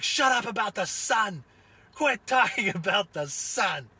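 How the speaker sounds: high, slow, mock scream at a coworker; retold scream, breaking into a laugh near the end; imitated screaming. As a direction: scream it at him like you've finally snapped. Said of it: "that was screaming at Andy".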